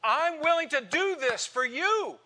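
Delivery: Paul pleading. Acted. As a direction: cry it out as Paul begging his church.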